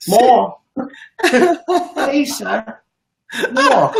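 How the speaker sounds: put-on high pleading little-boy beg; Oliver Twist begging. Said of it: "It's Oliver Twist".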